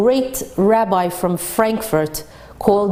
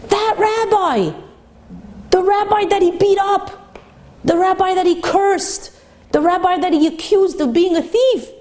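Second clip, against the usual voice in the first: much higher, astonished storyteller's build; dramatic exclamation; 9 semitones higher than usual. A narrator's reading. Preacher revealing the beaten rabbi is the speaker, astonished. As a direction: hammer each repeated phrase higher.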